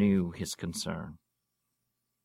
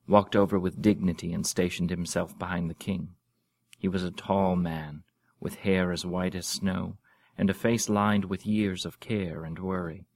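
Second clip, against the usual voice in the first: plain reading voice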